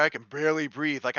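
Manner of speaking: ordinary talk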